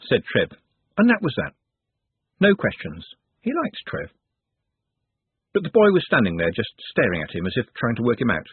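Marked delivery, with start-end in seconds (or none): 3.4-4.1 high, soft, distant echo of the line; an eerie inner echo of the thought. A narrator's reading. Narrator echoes the boy's thought, high and distant.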